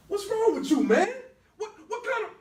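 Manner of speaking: loud, high furious yell; angry shout. Acted. Brother yelling high and loud at his brother for the drugs. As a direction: blast him with outrage.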